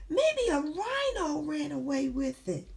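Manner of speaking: put-on high-pitched storybook child's wail; excited character guess. Voices the character's next guess.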